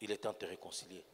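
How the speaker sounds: hushed prayer invitation. Acted. Preacher softly invites the standing congregation to reconcile with God. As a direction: draw them quietly into prayer.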